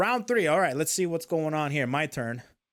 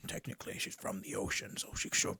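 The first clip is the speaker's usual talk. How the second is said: very soft, half-whispered reasoning; thinking to oneself; 10 semitones higher, 9 dB quieter than usual. Retold or imitated. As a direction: murmur it under the breath. Retelling private reasoning during the game.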